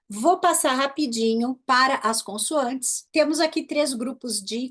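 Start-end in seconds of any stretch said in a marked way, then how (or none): none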